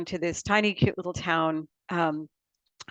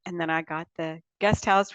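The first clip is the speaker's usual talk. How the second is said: ordinary talk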